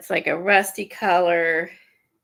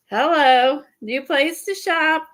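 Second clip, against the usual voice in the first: higher, perky mock hello; eager shopper greeting; 6 semitones higher, 4 dB louder than usual. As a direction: call out like a keen customer. Acting out shoppers' keen greeting.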